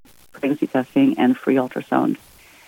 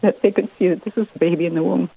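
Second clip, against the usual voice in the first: teary-eyed; 3 semitones higher, faster than usual. Real, not acted. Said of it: "I get teary-eyed when I look at it".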